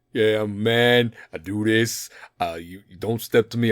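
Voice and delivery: a hint of a posturing wannabe hard man; fake tough guy. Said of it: "The ones that are like".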